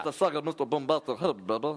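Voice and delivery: fast amateur reggae patter. Shown as a demonstration. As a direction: rattle off the star's catchphrases. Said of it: "I think I could do [name]".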